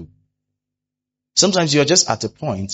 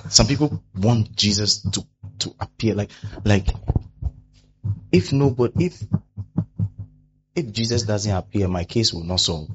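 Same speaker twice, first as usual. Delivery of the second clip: ordinary talk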